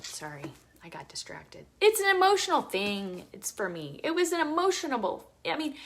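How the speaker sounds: normal talking voice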